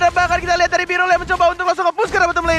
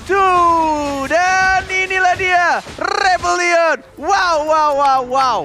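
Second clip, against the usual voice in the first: excited caster; 3 semitones higher, much slower than usual. Real, not acted. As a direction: hype the crowd. Co-caster celebrates the win.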